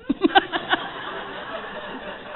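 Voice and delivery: high breathy giggle; laugh. Real, not acted. Laughing at her old answer to her sponsor. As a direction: giggle at the memory.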